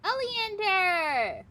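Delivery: high, long holler of a name; hollered shout that falls at the end; loud drawn-out call. Acted. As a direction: call out across the distance, stretching the name. Calling out to a character.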